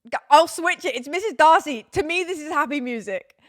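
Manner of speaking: loud, high-pitched playful defence; mock-indignant protest. Real, not acted. Protests about her music choice.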